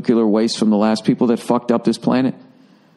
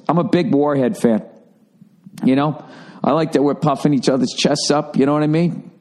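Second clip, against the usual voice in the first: usual speaking voice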